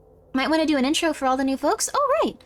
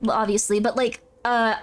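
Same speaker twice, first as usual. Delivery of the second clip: normal talking voice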